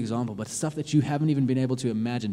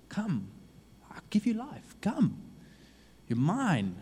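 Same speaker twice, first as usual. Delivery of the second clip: hushed, then much higher, tender call; God pleading gently; 9 semitones higher, slower than usual. Acted. God begs his child to come back, hushed and pleading. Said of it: "God is looking at you and saying".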